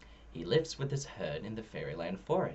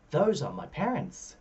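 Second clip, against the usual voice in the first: gasping; 6 semitones higher than usual. A narrator's reading. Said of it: "Alabaster gasps".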